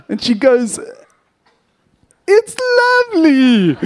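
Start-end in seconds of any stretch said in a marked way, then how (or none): 2.3-3.8 very high, loud, cracking gush; mum's strained praise. Retold or imitated. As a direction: squeeze out a shrill forced compliment like a mum hiding the truth. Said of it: "like this".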